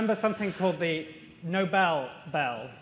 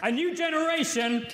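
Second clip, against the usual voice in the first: higher-pitched opening, punchy headline read; newsy; 4 semitones higher than usual. Acted. Co-anchor showing Jeff how to be newsy, headline voice. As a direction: announce it like breaking news. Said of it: "Do, be, be more newsy".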